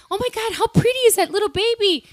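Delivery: high-pitched mock cooing; imitated squeal of adoration; gushing over a baby. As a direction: gush like someone admiring a baby. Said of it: "well, they're like".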